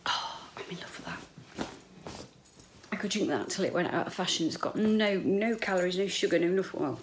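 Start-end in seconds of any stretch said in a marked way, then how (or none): 0.1-1.2 whispered. Real, not acted. After sip of drink.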